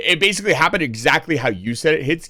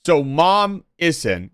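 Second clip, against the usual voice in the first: ordinary talk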